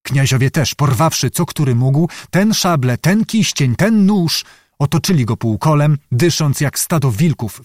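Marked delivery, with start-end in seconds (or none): none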